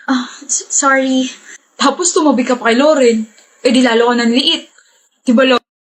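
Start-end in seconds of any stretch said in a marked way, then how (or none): none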